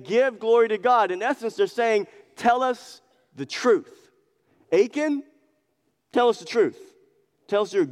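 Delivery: pushy questioning, half acted; demanding Pharisees. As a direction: press him to confess. Pharisees pressing the man.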